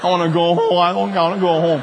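put-on playful childlike wail; mock whining. Preacher laughed at by congregation, mock whining. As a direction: pretend to sulk and plead to leave.

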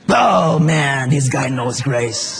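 the devil thwarted, muttering it out slowly; frustrated devil. Pretend. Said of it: "the devil reacts after the believer answers".

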